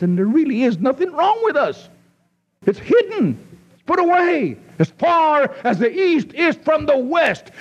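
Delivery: high, rising revival preaching; impassioned preaching. Acted. Pastor pleading with his flock, impassioned preaching. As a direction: lift the congregation with fervent pulpit fire. Preacher building to full force.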